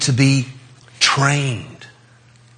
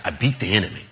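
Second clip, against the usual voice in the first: usual speaking voice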